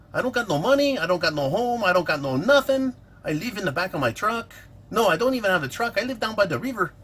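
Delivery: hammed-up Mexican accent; mock Mexican accent. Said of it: "people with that accent".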